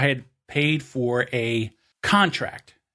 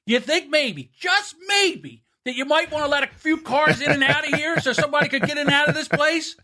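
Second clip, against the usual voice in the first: retelling a rant, voice raised in pitch only; yelling out the window; 10 semitones higher than usual. Retold or imitated. Driver yelling at traffic guards, high mock yell. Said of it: "I yell out the window".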